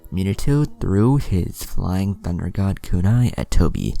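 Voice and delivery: plain reading voice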